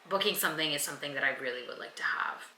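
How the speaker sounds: hushed whisper of a wish. Real, not acted. Vlogger whispering her wish to viewers. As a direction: breathe it like a secret.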